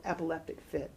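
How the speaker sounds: ordinary delivery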